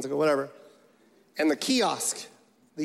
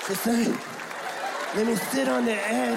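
mock meek plea, laughing; lightly voiced begging boy; pleading kid. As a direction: ask to sit on the edge, meek. Begging the bully for seat.

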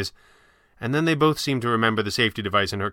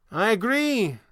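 high-pitched stranger's voice; high, theatrical agreement; old stranger; 12 semitones higher, slower than usual. Acted. Stranger's character voice in dialogue.

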